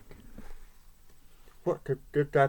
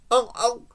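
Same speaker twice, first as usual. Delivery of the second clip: higher snorting onk onk; pig oinks; 9 semitones higher than usual. A narrator's reading. The pig in the farmyard uproar, snorting onks. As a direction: grunt like a pig. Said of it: "go the pig".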